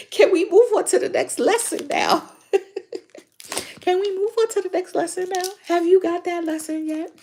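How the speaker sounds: mock high-pitched impatience; high, exaggerated nagging voice, hurrying along; exasperated spirit guides. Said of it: "They like".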